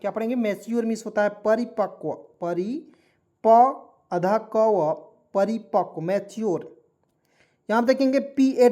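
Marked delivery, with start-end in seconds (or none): 2.4-4.9 परिप- drawn out slowly, then अधा क व separately; part by part. Shown as a demonstration. Teacher dictating Hindi spelling to learners, part by part. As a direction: break it into pieces, pausing between परिप- and अधा क व.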